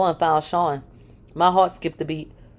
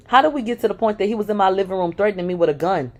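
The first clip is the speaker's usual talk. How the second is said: ordinary delivery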